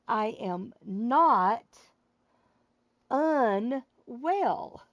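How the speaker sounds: very slow, deliberate, every word apart; said slowly. Shown as a demonstration. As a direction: spell it out slowly like to a slow listener. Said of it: "repeat it slowly for them".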